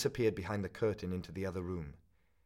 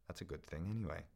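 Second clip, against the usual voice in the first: quiet inner thought; 6 dB quieter, faster than usual. A narrator's reading. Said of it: "he thought to himself".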